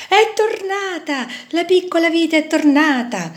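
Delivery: high, joyful cry; happy shout. Acted. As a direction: welcome a lost sister home. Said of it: "gridarono felici le altre viti".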